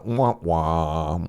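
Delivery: descending, mock-disappointed "wah wah"; sad trombone wah-wah. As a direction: voice a comic letdown trombone. Imitates a sad trombone letdown.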